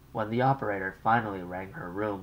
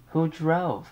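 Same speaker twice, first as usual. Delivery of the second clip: higher, louder fretful mother's voice; an anxious mother on the line; 9 semitones higher, 7 dB louder than usual. Acted. Mother calls her daughter long-distance, fretful and raised.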